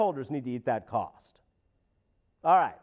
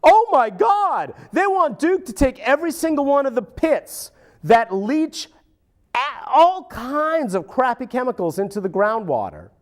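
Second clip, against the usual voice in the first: high, louder mock voice of a dismissive official; a higher, louder parody of an official brushing off environmentalists; 10 semitones higher, 6 dB louder than usual. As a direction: sneer it out high as the smug bureaucrat. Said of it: "He says things like".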